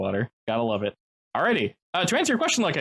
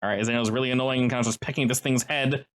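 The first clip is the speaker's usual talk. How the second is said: ordinary talk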